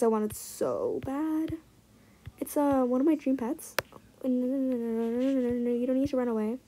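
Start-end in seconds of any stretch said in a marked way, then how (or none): none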